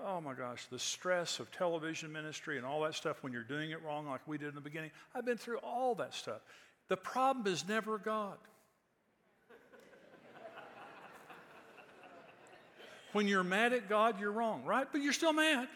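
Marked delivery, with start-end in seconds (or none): 5.1-15.7 high, loud preaching push; preaching at full force. Acted. Preacher pressing the church on being mad at God, loud and high. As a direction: drive it home to the congregation.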